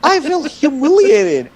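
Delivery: high, slow hammed-up whimper; mock wounded. Man playing the humiliated victim, high slow whine. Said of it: "retelling mock hurt".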